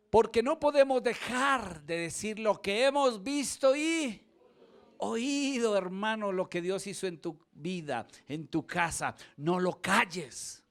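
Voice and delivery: loud, high fervent preaching; preaching at full force. Acted. Preacher urging congregation to testify, loud fervent. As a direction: proclaim it to the whole church.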